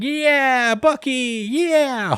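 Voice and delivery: high, loud cheer; class cheering. Acted. The kids cheer their pardoned chicken friend, high and loud. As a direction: cheer for Bucky like a whole happy class. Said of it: "everybody's like".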